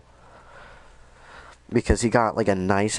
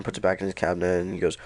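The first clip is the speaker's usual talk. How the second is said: ordinary delivery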